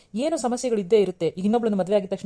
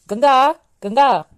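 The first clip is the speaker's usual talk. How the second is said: long, slow, loud and high call; 6 semitones higher, 8 dB louder, much slower than usual. Acted. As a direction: bellow her name across the house. Husband calls his wife sharply. (ಹೆಂಡತಿಯನ್ನು ಗಟ್ಟಿಯಾಗಿ ಕೂಗಿ ಕರೆಯುವುದು)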